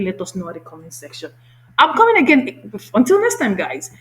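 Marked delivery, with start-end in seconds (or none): 1.8-3.9 high, loud cheery outro; excited sign-off. Real, not acted. Host saying goodbye to viewers, loud and cheerful. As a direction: call out to the viewers.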